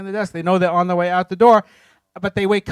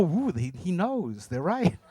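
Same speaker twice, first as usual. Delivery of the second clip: a soft low hushed ooh, mock impressed; awed executives; 4 semitones lower, 9 dB quieter, slower than usual. Executives conceding the pitch, mock awed and hushed. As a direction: lightly voice the stunned bosses, lower and softer.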